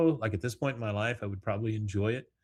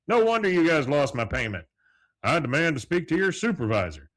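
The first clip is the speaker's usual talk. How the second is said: louder, higher mock outrage; acted indignation; 5 semitones higher, 6 dB louder than usual. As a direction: play the irate customer demanding satisfaction. Hank demands supervisor after learning India.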